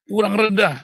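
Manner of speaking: high, loud drill command; trainer's shout. Acted. Trainer to an exhausted push-up trainee, shouted command.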